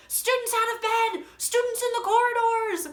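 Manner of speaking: high, loud alarmed cry; Filch. Acted. Vlogger acting out the caretaker's alarm, high loud cry. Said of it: "I love this, Filch".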